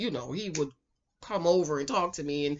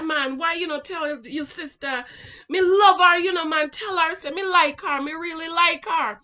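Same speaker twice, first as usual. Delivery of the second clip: put-on higher-pitched Jamaican accent, pleading; imitated Jamaican boy; 7 semitones higher, 4 dB louder than usual. Boy begging her brother to tell her, pleading in Jamaican accent. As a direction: plead like a lovestruck Jamaican lad. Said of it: "this how they talk".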